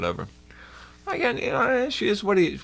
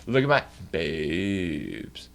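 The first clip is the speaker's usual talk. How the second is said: lower, softer, slow mocking boast; hammed-up sultry brag; mock show-off; 8 semitones lower, 5 dB quieter, much slower than usual. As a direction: drag it out like a smug show-off. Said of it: "they're like".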